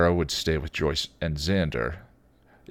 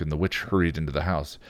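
ordinary delivery

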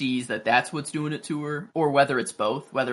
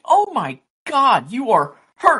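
higher, louder and slow, a hammed-up gasp of worry; mock alarmed Daenerys; 9 semitones higher, 7 dB louder than usual. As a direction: play her shocked concern big and drawn out.

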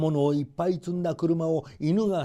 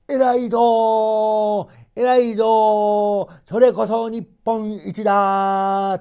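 loud, much higher, slow overjoyed shout; proud grandfather; 8 semitones higher, 8 dB louder, slower than usual. Acted. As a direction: shout his pride at the returning hero. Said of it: "とおじいさんは言いました". (喜びで高く叫ぶおじいさん)

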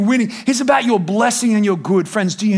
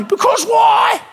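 high whiny why; whiny child; 11 semitones higher, much slower than usual. Retold or imitated. Preacher mimicking a childish why to God. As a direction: ask it like a sulky toddler.

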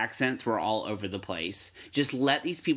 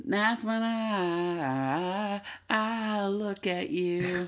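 slow, higher breathy movie line; 9 semitones higher, much slower than usual. Retold or imitated. Speaker mimics the film's heroine, slow and higher. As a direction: drag out a dreamy movie quote. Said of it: "She's saying".

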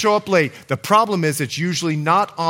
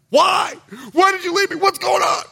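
venting; 10 semitones higher than usual. Acted. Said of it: "He's venting".

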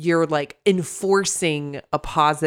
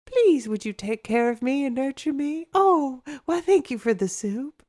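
high, needy teddy bear voice; mock-helpless cuddly patient; 9 semitones higher than usual. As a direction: plead sweetly to be nursed, pitched up. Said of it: "some of us are more like".

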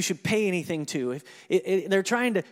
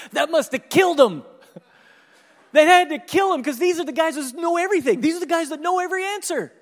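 much louder, higher gleeful outburst; excited preaching; 10 semitones higher, 9 dB louder than usual. Real, not acted. Preacher to congregation about stumped priests, gleeful loud outburst. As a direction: crow about the know-it-alls being stumped.

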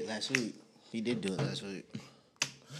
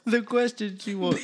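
laughing; 4 semitones higher than usual. Real, not acted. Laughing while restarting question.

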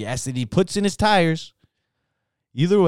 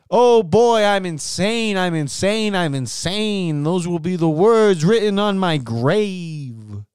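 high, drawn-out mock raving; hammed-up madness; 6 semitones higher, 4 dB louder, slower than usual. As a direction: wail it theatrically, higher and slower as it goes. Hammed-up outburst of being insane.

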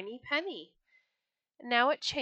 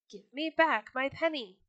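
crying out; 5 semitones higher, 5 dB louder than usual. Acted. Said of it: "he cried".

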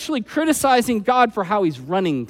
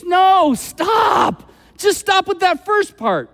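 shouted stop; 9 semitones higher, slower than usual. Real, not acted. Preacher cuts off the verse.

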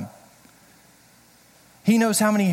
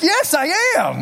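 put-on much higher, laughing Boston accent; answering Boston fans, laughing Boston accent; Boston accent reply; 11 semitones higher, 4 dB louder than usual. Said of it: "That's my Boston".

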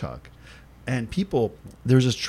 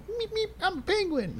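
very high, squeaky little penguin voice; cute penguin kid; 4 dB quieter than usual. Retold or imitated. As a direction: squeak it like a tiny cartoon penguin. Kids as penguins in game.

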